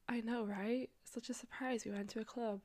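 hushed, mocking stage whisper; a dry whispered joke to the listeners; sarcastic whisper. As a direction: whisper "Such a surprise" as a wry aside. Sarcastic aside about the club.

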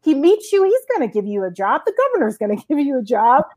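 high, bright-eyed encouragement breaking into a laugh; mom's eager cheer. Retold or imitated. Mom cheering her daughter on about the senator job, high and eager. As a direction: gush with total faith in her. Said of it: "And she said".

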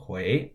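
"hui" lifting upward like a question; second-tone demo. Shown as a demonstration. Teacher models the syllable just spelled, second-tone demo. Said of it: "H U I in the second tone".

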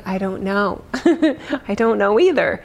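ordinary talk

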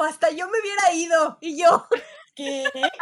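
loud, high, laughing retelling; laughing disbelief. Real, not acted. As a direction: shout it through laughter, incredulous. Retells boss's surprising reply, laughing. (gritando entre risas, incrédula)

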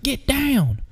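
very high, slow mock holler; squeaky-high impression of a frustrated older man; imitated frustrated yelling. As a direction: imitate the guide yelling, drawn out. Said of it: "he's yelling this".

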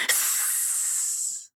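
soft unvoiced sss; long snake hiss. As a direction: let out a hiss. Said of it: "she hissed again".

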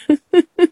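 high loud burst of laughter; real high laugh. Real, not acted. Laughing at the story.

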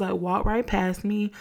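usual speaking voice

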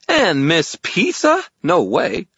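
high, loud, stubborn fairy; scowling fairy. A narrator's reading. Fairy refuses to guide wizard, indignant high voice. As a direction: refuse it slowly in a high pout. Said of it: "thrust out his little chin and scowled".

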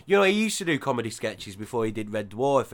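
normal talking voice

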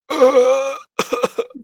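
squeaky cry-like laugh; high wheezing laugh. Retold or imitated. Chili in his sinuses, squealing laugh. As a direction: let out a pained high laugh. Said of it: "I was just like".

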